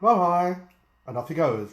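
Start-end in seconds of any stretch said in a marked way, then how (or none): 0.0-0.6 high, loud cartoon frog sign-off; wide mouth frog. Frog leaving the lion, high cartoon frog voice. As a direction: sing out the farewell in a big frog voice. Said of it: "So the wide mouth frog says".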